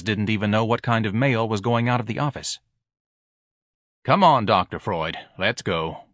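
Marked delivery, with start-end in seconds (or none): 4.0-6.0 gruff husband voice. A narrator's reading.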